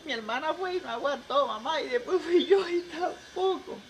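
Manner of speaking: high-pitched daughter's confession; hammed-up plaintive falsetto; mock tearful. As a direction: confess to mother in a high comic whine. Crying daughter confesses to mother.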